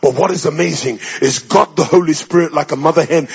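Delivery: ordinary delivery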